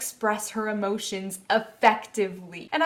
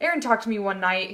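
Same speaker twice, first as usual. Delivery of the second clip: normal talking voice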